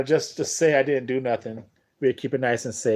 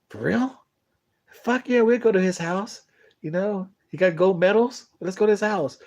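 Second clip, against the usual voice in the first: put-on higher-pitched, giddy girls' voice; excited ladies; 6 semitones higher than usual. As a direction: squeal it like thrilled party girls. The ladies cheer about the invite.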